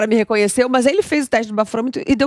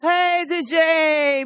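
high, drawn-out call across the studio; slow, long-drawn hey to the DJ; 6 semitones higher, much slower than usual. Real, not acted. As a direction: stretch it out to reach the DJ. Host calling out to the DJ.

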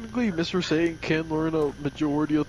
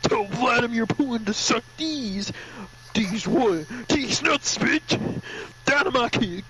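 higher, hammed-up battle taunt; trash-talk like a cartoon champion; Mister Satan; 6 semitones higher, slower than usual. Champion mocking his opponent Skokovic, hammed-up taunting. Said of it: "He's like".

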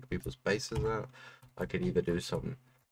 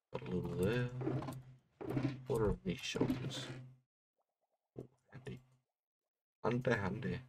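quiet but clear; 3 semitones higher, 8 dB quieter than usual. Real, not acted. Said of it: "sound a little quiet or a bit drained".

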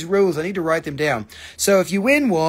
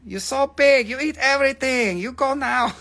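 high-pitched, accented mock scolding; comedy-routine impression; 8 semitones higher than usual. Restaurant host scolding a big eater, high mock accent. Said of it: "go back to the comedian style".

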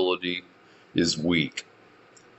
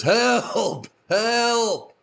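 high desperate cries of help; yelling for help; 11 semitones higher than usual. Retold or imitated. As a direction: still calling up for rescue. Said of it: "and says".

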